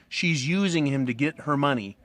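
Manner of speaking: ordinary delivery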